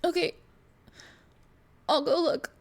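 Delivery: put-on small child's high voice. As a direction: play a little girl, high and small.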